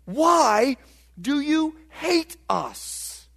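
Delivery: high-pitched, pleading protest to God; psalmists' lament. Acted. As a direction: cry out to God in hurt disbelief, drawing words out. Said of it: "and they say".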